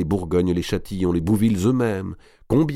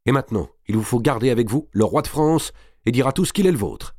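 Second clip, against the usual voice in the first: high, pressing instruction; insistent order; 5 semitones higher, 3 dB louder than usual. A narrator's reading. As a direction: impose it on her with urgency. Madame de Bouville donne ses ordres. (ordre pressant, voix haute)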